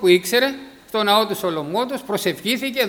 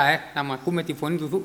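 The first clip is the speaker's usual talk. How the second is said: ordinary talk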